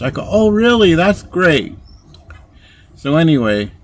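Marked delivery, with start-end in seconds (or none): 0.3-1.8 high, loud mock-amazed oh really; mock surprise. Answering the assistant's news, mock surprise. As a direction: act falsely amazed, then settle.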